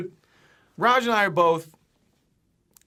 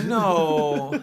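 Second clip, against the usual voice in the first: louder, drawn-out "no"; retold long no; 5 dB louder than usual. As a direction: act out a big, stretched refusal. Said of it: "I go".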